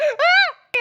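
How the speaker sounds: sudden high, loud scream; loud yelp. Acted. Startled by the woman coming in, high loud yelp. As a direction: yelp out at full pitch. Cries out as patient enters.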